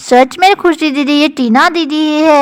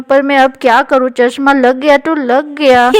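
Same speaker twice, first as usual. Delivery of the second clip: ordinary delivery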